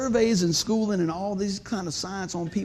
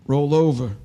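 low, louder, as if through a tiny mic; over a little microphone; 6 semitones lower, 6 dB louder than usual. Retold or imitated. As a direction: boom it out like a nurse on the intercom. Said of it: "She's on a little microphone".

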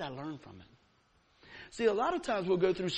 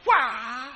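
battle yell; 4 semitones higher, 7 dB louder than usual. Retold or imitated. Throwing off mask at the plate.

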